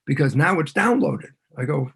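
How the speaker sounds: ordinary delivery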